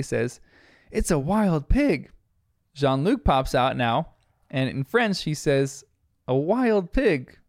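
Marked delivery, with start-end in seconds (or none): 0.9-2.1 higher, slow mock exclamation; lightly voiced young character spotting something; excited child character. Bluey spotting the pig in story. 6.3-7.4 higher, slow mock exclamation; lightly voiced young character; exclaiming child character. Jean-Luc voiced in story.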